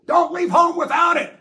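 very high, loud heckling shout; shouted wisecrack. Retold or imitated. Audience joker shouting at the movie screen. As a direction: yell the punchline across the theater. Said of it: "somebody in the audience would go".

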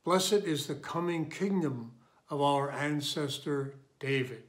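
usual speaking voice

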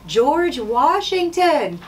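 slow, drawn-out high announcement; big reveal. Real, not acted. Teacher revealing the answer to the class with drawn-out excitement. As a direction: unveil the name like a prize.

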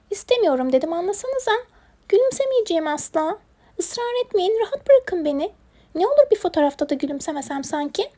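high-pitched protesting little creature; stubborn hedgehog. Acted. Hedgehog won't smile for photo, high stubborn protest. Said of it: "tutturdu bizim kirpik gülümsemeyeceğim diye".

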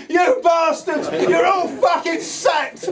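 put-on high, slow, loud drunken bellow; imitated angry shout. Drunk singer stuck on his bed yelling at the band, mock furious shout. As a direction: roar it out like a furious, drunk boss. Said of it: "you could hear him for hours".

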